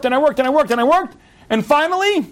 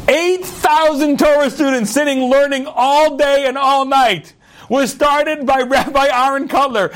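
fervent preaching; 10 semitones higher, slower than usual. Acted. Rabbi stirring his congregation with the story's ending, fervent preaching. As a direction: preach it out, awed and rising to a cry.

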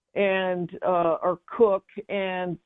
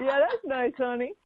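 lightly voiced mom, pitched up; imitating mom; 6 semitones higher than usual. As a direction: echo mom's reply in a higher voice. Repeats mother's reply after laughter.

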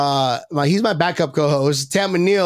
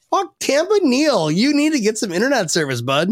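higher, mock-exasperated viewer yelling at Neil; mock annoyed viewer; 7 semitones higher than usual. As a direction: play a fed-up fan scolding Neil, high. Viewers voiced mocking Neil.

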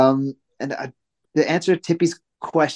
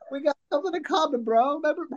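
high, softer joke voice; mock chummy plea; 14 semitones higher, 4 dB quieter, faster than usual. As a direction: play the hopeful old pal in a high voice. Imagined call to a wrestler.

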